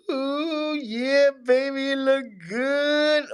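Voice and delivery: high, slow admiring croon; admiring swagger. Acted. Admiring his new gold profile picture.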